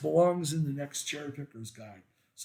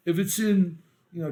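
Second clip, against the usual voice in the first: ordinary talk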